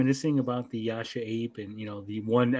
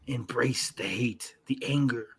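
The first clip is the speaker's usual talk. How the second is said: slow, menacing whisper; a mock Sith whisper; whispered villain; 2 semitones lower, 6 dB quieter than usual. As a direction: hiss it like a dark tempter. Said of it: "And Yujiro says".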